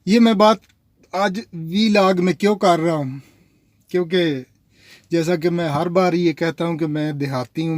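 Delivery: normal talking voice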